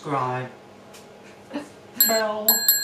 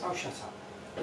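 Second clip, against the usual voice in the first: whispered; 4 semitones higher, 8 dB quieter, much slower than usual. Real, not acted. Told off while filming.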